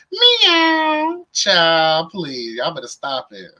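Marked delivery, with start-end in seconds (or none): 0.1-1.3 high squeaky cat meow; playful meow. Pretend. Teasing Cats Meow in chat with a high cat meow. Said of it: "Cats meow".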